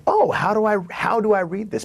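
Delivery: high, bewildered question; mock puzzled voice; puzzled reader. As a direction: play the confused reader.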